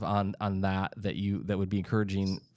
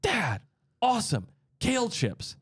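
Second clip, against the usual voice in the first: put-on much higher, eager kid voice; dad retelling his son's delight at kale chips, high and eager; excited teen son; 12 semitones higher than usual. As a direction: gush like a thrilled thirteen-year-old. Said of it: "he goes".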